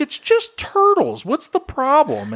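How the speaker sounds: very high, slow coaxing voice; sweet hairdresser voice. Retold or imitated. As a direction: coax a kid in a sugary high voice. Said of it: "she was like".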